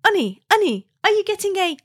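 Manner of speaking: high-pitched teasing put-on Wigan accent; husband mimicking Wigan accent. Husband teasing wife about pudding, mock Wigan accent. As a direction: imitate an outsider gleefully trying Wigan speech. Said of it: "doing the accent as well".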